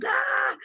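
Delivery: high mock scream of terror; retold scream. Surfers seeing the huge set, imitated group scream. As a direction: shriek like the wave is on you. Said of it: "they screamed in unison".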